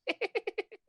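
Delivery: high, far-off cackle; a hidden figure cackling; eerie high cackle. Acted. Hidden stranger cackles somewhere in the room.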